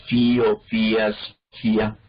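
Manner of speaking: fío, fías, fía each stressed on í; hiatus. Shown as a demonstration. Teacher listing clear hiatuses, fío, fías, fía with í stressed. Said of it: "claramente hiatos".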